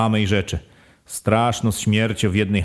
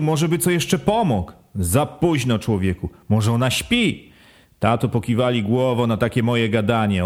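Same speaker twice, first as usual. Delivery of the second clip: ordinary delivery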